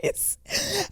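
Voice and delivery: high, gushing mock awe; self-mocking wide-eyed wonder; naive self. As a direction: swoon with clueless gratitude. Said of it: "my dumb ass goes".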